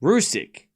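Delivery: Rusic ending in a hard k; trying another ending. Shown as a demonstration. Streamer asking whether the name's end is wrong, trying another ending. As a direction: say Rusic as ROO-sick. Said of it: "Am I saying it wrong at the end?".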